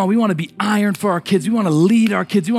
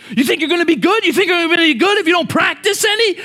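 put-on dad yelling at kid to practice; pushy sports dad; 9 semitones higher than usual. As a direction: push the kid hard like a sideline dad.

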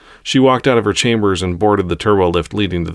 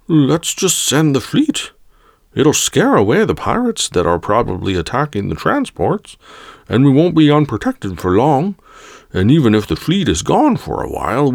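higher-pitched put-on character voice; eager junior voice, pitched up; young Bothan councillor; 7 semitones higher than usual. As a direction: pipe up with a suggestion in council.

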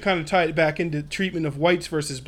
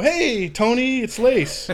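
higher, slow, sing-song hey to dad; overly cheerful greeting; 7 semitones higher, 4 dB louder, much slower than usual. Retold or imitated. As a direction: act super casual to hide trouble. Calling his father from jail.

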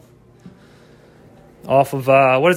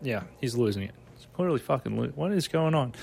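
mock viewer aside; 4 semitones lower, 12 dB quieter than usual.

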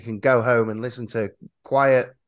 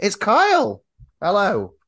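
excited; 8 semitones higher, 5 dB louder than usual. Real, not acted. Excited guest introduction.

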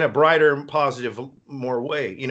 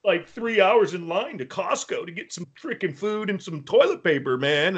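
whiny complaining friend; 4 semitones higher than usual. Retold or imitated. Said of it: "He's like".

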